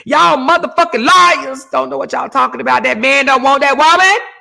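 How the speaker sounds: high, loud mock outburst; angry fans yelling. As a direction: cuss out the liars. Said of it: "They was cussing us out".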